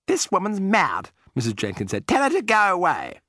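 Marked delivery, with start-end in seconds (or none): 0.1-1.1 high, slow, disdainful lady; haughty lady voice. A narrator's reading. Mrs Jenkins outraged at grandmother. 2.1-3.2 haughty lady voice. A narrator's reading. Mrs Jenkins dismissing grandmother.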